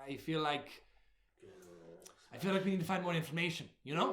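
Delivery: lightly voiced rabbit companion; a hint of a rabbit character, thinking aloud; rabbit friend. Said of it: "your rabbit friend".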